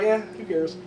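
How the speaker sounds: soft, offhand dismissal; indifferent shrug. Retold or imitated. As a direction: toss it away like a verbal shrug. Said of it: "I was just like".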